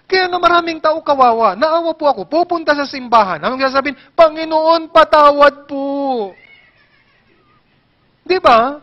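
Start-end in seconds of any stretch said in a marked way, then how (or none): 4.1-6.3 slow, drawn-out begging prayer, louder; a hammed-up tearful plea to God; pleading penitent. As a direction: plead for forgiveness like a weepy churchgoer. Imitating a weepy penitent's prayer.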